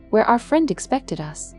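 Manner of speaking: ordinary delivery, nothing marked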